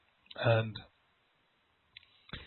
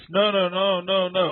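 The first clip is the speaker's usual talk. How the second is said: put-on insistent toddler; 6 semitones higher, 6 dB louder than usual. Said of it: "and they'd say".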